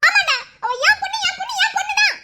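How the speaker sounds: high, crying out my daughter; anguished defiant shout. Acted. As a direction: hurl it back at him in anguish. Mother shouts back in defiance.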